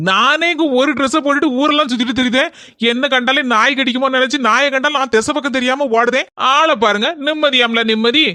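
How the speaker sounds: usual speaking voice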